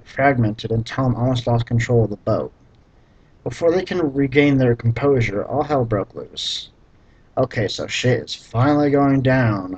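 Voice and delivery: ordinary delivery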